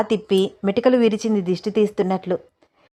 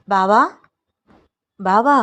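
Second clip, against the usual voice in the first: a searching call, lightly raised; 4 dB louder, slower than usual. A narrator's reading. Wife looking round the room for her husband, calling out. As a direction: call out to find him. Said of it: "అని పిలుస్తూ".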